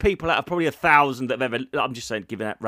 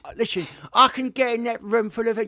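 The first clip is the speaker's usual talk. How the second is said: lightly voiced old club comic, higher; boastful veteran circuit comedian; old-timer comic voice; 6 semitones higher than usual.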